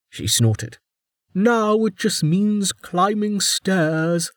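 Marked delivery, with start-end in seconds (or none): 1.3-4.3 high, slow dry scorn; mock the word; scornful warden. A narrator's reading.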